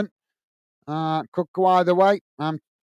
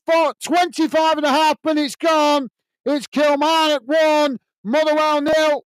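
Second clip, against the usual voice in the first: excited goal call; 8 semitones higher than usual. Real, not acted. Commentator announcing Kilmarnock's goal to the live chat, excited high shout. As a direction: erupt as the ball hits the net.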